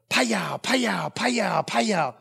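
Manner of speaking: imitated smack sound, repeated evenly; four falling vocal whacks; spanking smacks. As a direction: sound out each swat like a comic effect. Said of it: "the bartender heard".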